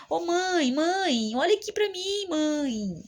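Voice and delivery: high, pleading call to mother; begging plea. Retold or imitated. Daughter begging her confused mother to look at her, high plea.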